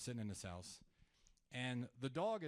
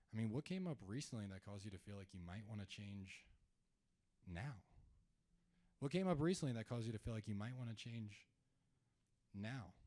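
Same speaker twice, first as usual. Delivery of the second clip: curious and concerned; 3 semitones lower, 8 dB quieter than usual. Shown as a demonstration. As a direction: ask gently, like you truly care. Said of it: "what type of tone did I just use".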